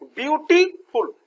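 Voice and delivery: Beautiful slow and high, in separate beats; broken into syllables. Shown as a demonstration. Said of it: "ये दो syllable का है".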